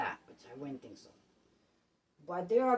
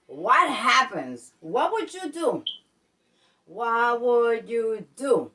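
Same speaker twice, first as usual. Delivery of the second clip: slow dramatic question; 7 dB louder, much slower than usual. Acted.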